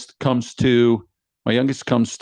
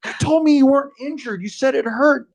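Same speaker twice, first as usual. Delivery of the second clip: high, indignant mock protest; protesting retelling; 13 semitones higher than usual. Dad defends himself to injured daughter, protesting. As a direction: argue back in a raised pitch, half amused.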